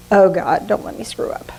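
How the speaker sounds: hushed mock prayer to God; a nervous little plea. Imitating her nervous prayer at the back of church.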